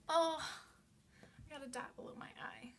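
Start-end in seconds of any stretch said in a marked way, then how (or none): 0.1-0.4 sudden loud high yelp; startled oh. Real, not acted. Startled yelp when the cat makes her poke her eye. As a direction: jolt out the oh.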